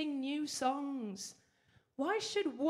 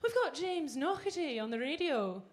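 put-on Scottish accent, Glaswegian; comic Glaswegian accent; Glasgow accent; 3 semitones higher than usual. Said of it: "You'll not hear anyone from Glasgow".

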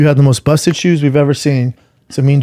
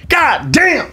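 high-pitched, slow boasting; drawn-out, high show-off exclamation; loud customer imitation; 9 semitones higher, slower than usual. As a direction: crow about the shoes for everyone around. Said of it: "I'm talking in front of everyone".

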